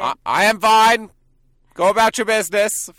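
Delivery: high, loud put-on cop brushing people off; mock drunk officer. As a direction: wave them away as a hammered policeman. Plays the drunk cop replying.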